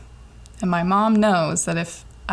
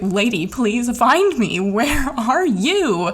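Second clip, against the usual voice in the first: slow, higher, stretched-out whine; drawn-out mock plea; 4 semitones higher, slower than usual. To the phone dispatcher, a slow exaggerated plea. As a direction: drag every word out like a pleading sigh.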